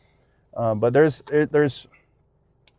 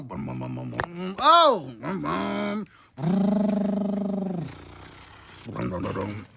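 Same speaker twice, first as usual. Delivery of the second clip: truck engine noises; 3 semitones higher, 6 dB quieter than usual. Pretend. Playing monster trucks with toddler.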